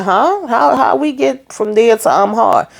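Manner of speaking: a real what-did-he-just-say; baffled high-pitched huh; incredulous. Real, not acted. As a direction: pitch shoots way up in disbelief. Host reacts in disbelief to Gary.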